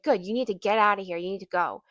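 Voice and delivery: imitated yell, loud at the start; mock yelling. Woman ordering a mother to leave, mock yelling. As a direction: retell her curt dismissal with a loud attack. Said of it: "she just keeps yelling at me".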